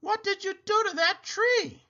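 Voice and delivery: high-pitched outraged demand; a shocked scolding question; angry shout. Acted. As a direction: scold her in disbelief. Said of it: "she shouted".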